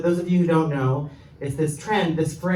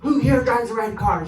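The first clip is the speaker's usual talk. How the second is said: woman on a mission; 5 semitones higher, 3 dB louder than usual. Retold or imitated. Woman hunting a car's owner in a cafe, mock accusing demand. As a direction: demand it like someone hunting down a culprit. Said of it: "like, on a mission".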